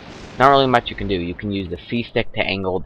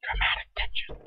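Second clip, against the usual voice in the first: whisper; 11 dB quieter than usual. Pretend. Mock dramatic aside.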